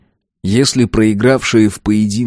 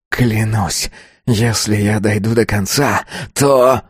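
growling oath; growled threat; slower than usual. A narrator's reading. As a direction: growl it as a held-back threat. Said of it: "зарычал тот". (угрожающе прорычать)

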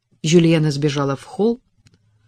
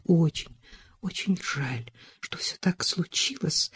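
softer, almost breathed regret; crestfallen near whisper; 7 dB quieter than usual. A narrator's reading. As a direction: fade into a sorry hush. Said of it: "упавшим голосом произнесла Элизабет".

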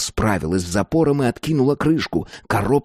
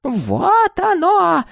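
very high, drawn-out crone cry; excited high-pitched outcry; old woman crying out; 4 dB louder, much slower than usual. A narrator's reading. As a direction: cry out in triumph, slowly and shrilly high. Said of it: "закричала старуха".

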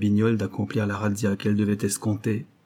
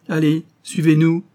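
sharp, loud police command; barked order; 7 semitones higher, 7 dB louder than usual. A narrator's reading. Policeman ordering the couple to follow, loud and curt. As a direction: push them out the door with a loud order.

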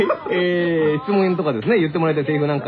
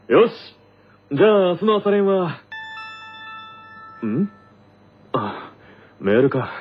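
a lightly voiced, firm captain rallying the team; captain planning practice, then surprised by a mail, lightly voiced; team captain character; 2 semitones lower than usual. In-character radio skit.

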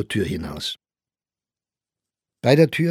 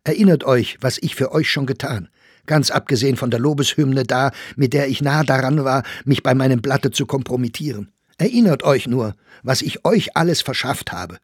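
raised, urgent voice pressing on; heated reproach; 4 semitones higher, 4 dB louder than usual. Acted. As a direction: remind them indignantly of your favours. (aufgebrachter, eindringlicher Vorwurf)

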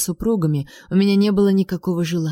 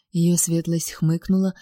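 ordinary delivery, nothing marked

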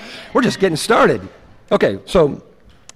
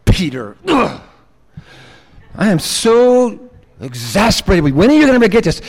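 exasperated. Acted. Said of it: "exasperation in Jesus' voice".

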